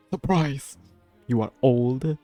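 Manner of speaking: ordinary talk, nothing marked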